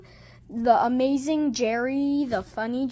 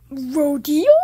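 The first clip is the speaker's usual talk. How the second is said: ordinary talk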